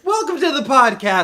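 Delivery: high, loud formal welcome; official announcer voice. Pretend. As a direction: announce it like a show host. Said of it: "the more official sounding one".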